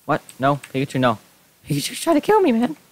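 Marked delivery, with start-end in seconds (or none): 1.7-2.8 much higher, squealing alarm; panicked squeal. Real, not acted. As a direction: cry out as if under attack. Attacked by Pikachu in game.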